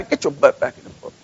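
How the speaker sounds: low, soft mock scold; a lightly voiced telling-off; playful low rebuke; comic scolding Jesus.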